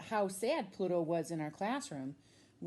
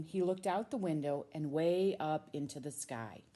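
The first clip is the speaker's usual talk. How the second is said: ordinary delivery, nothing marked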